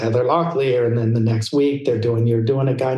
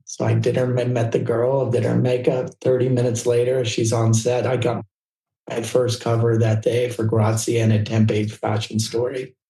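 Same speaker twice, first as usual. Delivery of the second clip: ordinary talk, nothing marked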